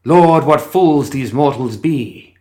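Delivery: slow, high, loud stage declamation; theatrical proclamation. Acted. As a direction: boom it out like Puck on stage. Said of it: "switched its manner of speech yet again".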